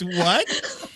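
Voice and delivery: a laughing "What?"; "What?" asked through a laugh. Retold or imitated. Wife laughing at husband's question, laughing. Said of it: "She goes".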